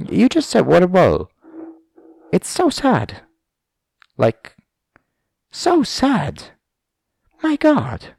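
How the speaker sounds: high-pitched, scornful mock dismay; mocking listener. Listener mocking the podcaster's water bottle, high scornful voice. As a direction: pitch it way up in pitying disbelief.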